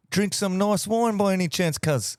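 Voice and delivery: high, loud hammed-up New Zealand bro voice; mock Kiwi accent. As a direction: play a cheeky Kiwi mate. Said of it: "better than a haka".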